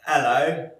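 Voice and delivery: loud, high-pitched British hello; mock British accent. Friend trying an Australian accent on the phone, mock British. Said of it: "She really sounded British".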